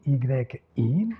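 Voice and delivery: ordinary delivery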